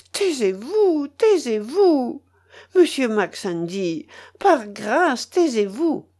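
high, pleading voice; desperate plea. A narrator's reading. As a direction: beg him to be silent. Mélanie begs the villain to stop.

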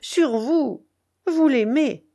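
high, ardent challenge; fiery. A narrator's reading. Rochaine challenges the baron about loving Gretchen, fiery. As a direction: fire it at him, insisting. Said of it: "reprit Rochaine avec feu".

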